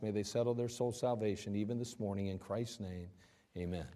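soft, hushed pastor's prayer. Acted. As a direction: pray quietly and earnestly to God. Opening prayer before sermon.

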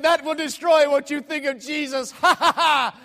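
high, shouted taunt into forced laugh; mock drunk stranger. Stranger taunting preacher about Jesus, loud gloating. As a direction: gloat loudly, then cackle "ha, ha, ha". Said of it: "I got a drunk on my hand here".

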